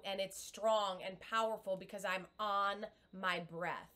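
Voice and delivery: ordinary talk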